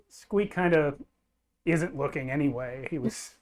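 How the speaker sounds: usual speaking voice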